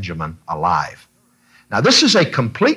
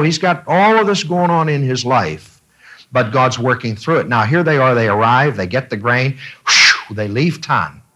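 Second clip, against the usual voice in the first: ordinary talk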